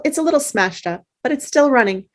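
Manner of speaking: ordinary talk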